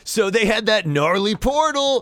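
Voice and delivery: high, loose dude voice, arms wide; surfer dude hug. Acted. Floon calling the pretty lady in for a hug, high dude voice. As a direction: pull her into a goofy grateful hug.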